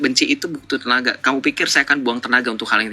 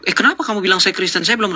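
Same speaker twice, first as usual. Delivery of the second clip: quick, higher, mock-defensive protest; 8 semitones higher, faster than usual. Streamer mocking a caller who hides his faith, fast, higher and defensive. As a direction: play it like a flustered denial, hurried. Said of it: "Saya nggak akan bilang".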